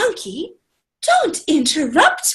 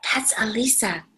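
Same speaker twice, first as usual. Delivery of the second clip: soft unvoiced aside; whispered aside; 6 semitones lower, 10 dB quieter, faster than usual. Real, not acted. Teacher quietly pointing out the child's name. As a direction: whisper it to her.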